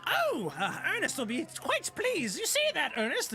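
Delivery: high-pitched goblin delight; pleased goblin. Acted. Goblin telling Ernest about the button, pleased high voice. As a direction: call it high, like a little goblin. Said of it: "the goblin just kind of like".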